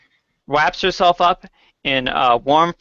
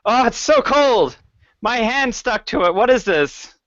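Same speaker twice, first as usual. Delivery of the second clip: pained cry, pitched up; screaming in agony; 6 semitones higher than usual. Acted. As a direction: yelp from a frozen stuck hand. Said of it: "he screams in agony".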